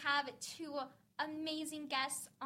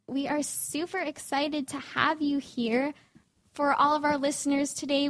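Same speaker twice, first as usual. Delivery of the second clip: louder, bright host welcome for the guests; upbeat host voice for the guests; podcast host intro; 9 dB louder than usual. Acted. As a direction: warmly welcome the kids to the show.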